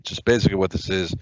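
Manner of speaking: usual speaking voice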